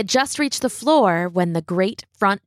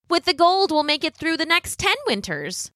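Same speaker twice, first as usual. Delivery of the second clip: mother voice; 8 semitones higher than usual. A narrator's reading. Mother pleading with son.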